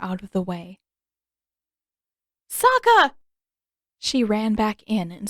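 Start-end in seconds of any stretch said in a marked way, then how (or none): 2.5-3.1 high, loud alarmed call of a name; frightened cry. A narrator's reading. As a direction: cry out in panic for her brother. Brother grabbed by spirit.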